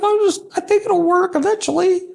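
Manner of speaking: very high, whiny excuse voice; mock hopeful client. Imitating a trainee who eats donuts, very high whine.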